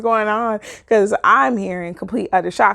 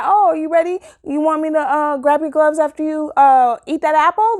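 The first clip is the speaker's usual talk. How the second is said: eager mock helpfulness; 7 semitones higher, 5 dB louder than usual. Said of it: "I would've been like".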